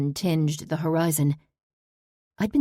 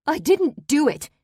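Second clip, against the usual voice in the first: much higher, loud, near hysteria; enraged, fearful shout; 11 semitones higher, 7 dB louder than usual. Acted. Parolee denies the murder to the daughter, loud hysterical shout. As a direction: yell the denial on the edge of hysteria. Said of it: "My loud voice filled the room, enraged, fearful, on the edge of hysteria".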